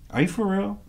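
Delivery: high-pitched disbelief; retold excited disbelief; incredulous. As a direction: ask it like you can't believe your luck. Retold disbelieving reply to friend.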